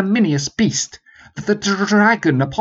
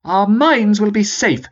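louder, higher sergeant voice; renewed with volume; 11 semitones higher, 5 dB louder than usual. Acted. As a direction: push the appeal with real volume. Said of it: "He renewed his appeal with volume".

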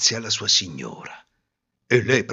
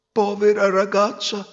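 lightly voiced, higher old woman; pitying old woman; 9 semitones higher than usual. Old mother of the wind pitying the girl, lightly voiced. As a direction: sigh with pity for the girl.

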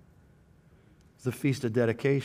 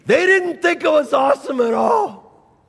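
mock shout; 13 semitones higher, 8 dB louder than usual. Mocking the crowd's reaction.

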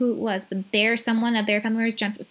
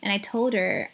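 ordinary talk, nothing marked

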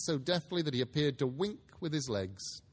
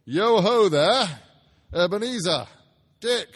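big booming jolly call, slow and loud; jovial call; 5 semitones higher, 12 dB louder, slower than usual. A narrator's reading. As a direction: call out like a fat, merry old master. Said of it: "called out in a comfortable, oily, rich, fat, jovial voice".

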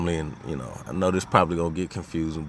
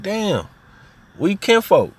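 much higher and louder "we kinfolk"; amazed kinship; 11 semitones higher, 4 dB louder, slower than usual. Retold or imitated. Cousins discovering they're related, high and thrilled. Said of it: "and be like".

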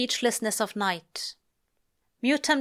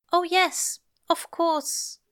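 flustered angel; 9 semitones higher, slower than usual. Acted. Aziraphale flustered.

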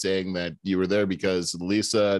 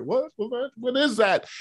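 high, straining to hear, baffled; high baffled what what; puzzled. Retold or imitated. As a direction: ask it high and puzzled, ear cocked. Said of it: "trying to hear".

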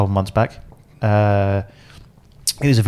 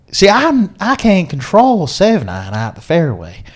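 put-on southern American accent; 8 semitones higher than usual. Golfer explains his conundrum to Bruce, in a hammed-up Southern American accent. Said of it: "is [name]'s southern American accent good or bad".

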